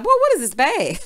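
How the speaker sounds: high, loud incredulous question; mock disbelief. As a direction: act out being offended by a cheap gift. Acting out her disbelieving reaction.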